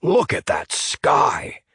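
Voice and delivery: villain admiring a coming storm; with relish. Acted. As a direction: taste each word. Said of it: "Sandy said with relish".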